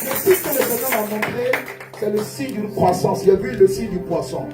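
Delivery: preaching at full force. Acted. Preacher rousing the congregation.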